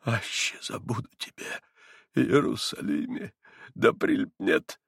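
broken whisper, choking on words. A narrator's reading. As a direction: gasp the psalm then choke. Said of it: "Он не договорил, как бы захлебнувшись". (захлёбываясь шёпотом)

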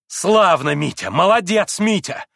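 loud, malicious cheer; spiteful exclamation. A narrator's reading. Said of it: "страшно злобная нотка прозвенела в её восклицании".

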